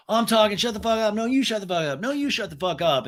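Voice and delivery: high, run-together squabble; mock bickering. Castmates overtalking each other, high mock bickering. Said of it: "over talking over each other".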